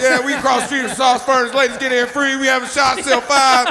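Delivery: hyped radio promo read; mock radio host announcer. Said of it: "you sound like a fucking radio host".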